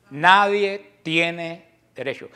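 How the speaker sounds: each word drawn out and leaned on, nadie hit hardest; slow emphatic. Real, not acted. Preacher to his flock, insisting, slow and emphatic. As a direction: spell out nadie tiene derecho slowly, word by word. Repeats his core claim.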